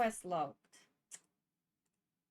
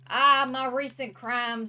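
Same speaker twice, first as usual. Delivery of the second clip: loud groan; 4 semitones higher, 13 dB louder, slower than usual. Pretend. Realizing he is wanted.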